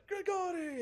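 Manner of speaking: very high, loud cry of a name; joyful shout. Acted. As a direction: call out the name with delight.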